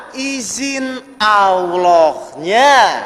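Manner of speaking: extremely slow, each word drawn out; stretched-out preacher's emphasis; solemn key point. Real, not acted. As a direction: answer your own question with long, weighty syllables. Answers own question, key point.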